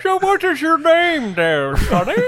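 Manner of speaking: put-on high, loud teasing old-timer; mock old-timer voice. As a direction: cackle it out like a folksy codger. Player teasing in character.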